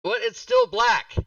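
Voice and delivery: high, loud outburst of frustration; exasperated yell. Real, not acted. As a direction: yell it in disbelief. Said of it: "Don't yell".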